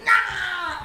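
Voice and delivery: loud, high mock yell; golem's cry. Little stone golem as the cart passes, high yell.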